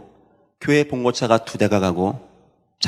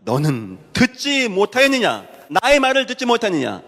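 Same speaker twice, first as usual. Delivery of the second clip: reproachful; 9 semitones higher than usual. Acted. Preacher voices God asking whether they have not heard, reproachful. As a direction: rebuke them as God, stretching the words out. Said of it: "하나님이 책망하듯이".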